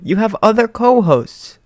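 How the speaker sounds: high, slow timid voice; shy retort. Acted.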